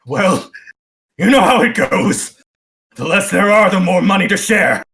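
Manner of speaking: high, loud dwarf voice; defensive dwarf. Acted. Dwarf justifies himself to the bugbear, high and loud. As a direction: call it out as a cornered treasure hunter. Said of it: "looks towards this fancy bugbear and says".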